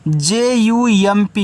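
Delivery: slow letter-by-letter spelling J-U-M-P of J यू M P; teacher spelling for children; spelled out slowly. Shown as a demonstration. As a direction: spell J यू M P one letter at a time. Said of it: "इसको पढ़ते हैं jump".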